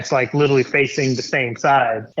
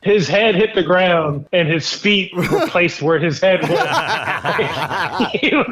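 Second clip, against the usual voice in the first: higher-pitched, breaking into laughter; laughing while talking; 5 semitones higher than usual. Real, not acted. Storyteller describing the kid's tumble to hosts, cracking up. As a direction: try to finish the story through laughter.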